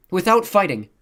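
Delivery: high, loud interjection; breaking in. A narrator's reading. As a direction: jump in over your co-host, pitched high. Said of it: "Simone broke in".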